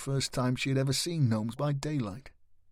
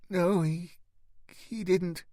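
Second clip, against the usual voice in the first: higher, halting, nervous denial; faint nervous denial; 7 semitones higher, slower than usual. Acted. Boy evading the knight's question, faintly and nervously. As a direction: stammer it out, not meeting his eyes. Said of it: "Gareth said faintly".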